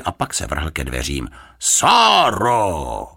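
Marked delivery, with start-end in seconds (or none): 1.6-3.1 high, drawn-out call; loud summons, pitched up and falling. A narrator's reading. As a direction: call out for your daughter. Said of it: "zavolal hlasitě".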